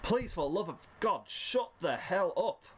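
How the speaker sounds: high, exasperated begging, held back in volume; restrained narrated scream, slowing at the end; screamed plea. A narrator's reading. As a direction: beg for it to stop with the pitch pushed up. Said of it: "you scream".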